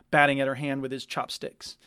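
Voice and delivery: usual speaking voice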